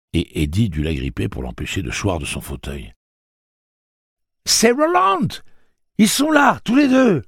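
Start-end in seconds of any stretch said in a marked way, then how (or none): 4.4-7.2 high, loud joyful yell; thrilled high shout of recognition; overjoyed shout. Acted. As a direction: cry out in sheer delight. Susanna spots her lost friends.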